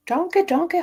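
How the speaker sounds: high mocking taunt; mocking singsong. Taunting a rival YouTuber with a singsong nickname. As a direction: sing it out to mock her.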